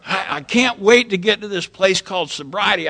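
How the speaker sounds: usual speaking voice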